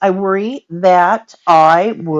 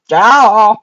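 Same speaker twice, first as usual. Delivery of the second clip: sudden loud "Ah!"; loud exclamation; 11 dB louder than usual. Real, not acted. Grandma reacting to the baby choking, loud exclamation. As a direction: burst out with a drawn-out cry.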